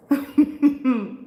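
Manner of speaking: loud high burst of laughter; big laugh. Real, not acted. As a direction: crack up out loud at the comment. Laughs at viewer comment.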